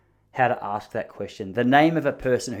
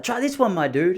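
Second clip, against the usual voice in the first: high, laid-back stoner voice; high-pitched stoner offering a sample; imitating the stoner; 6 semitones higher than usual. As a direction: put on the friendly pothead salesman. Imitating the weed shop guy.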